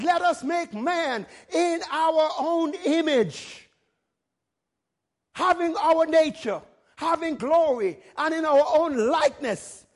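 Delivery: ringing pulpit delivery; preaching proclamation. Acted. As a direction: proclaim it grandly, stretching the words. Preacher proclaiming God's words.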